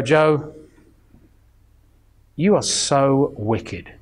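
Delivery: low, slow, grave pronouncement; stern mentor. Retold or imitated. The scary Bible teacher condemning young Joe, low and slow. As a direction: drop deep and slow to sound damning.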